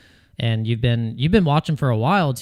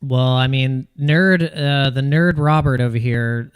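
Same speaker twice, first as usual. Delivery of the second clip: usual speaking voice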